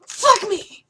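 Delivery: high, very loud yelled curse; furious shout. Real, not acted. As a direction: scream it out. Shout at game.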